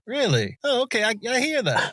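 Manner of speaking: high, swooping mock realization; acted surprised reaction. Listener acting out hearing the influence, high-pitched surprise. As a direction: jump way up in pitch, playing pleasantly surprised.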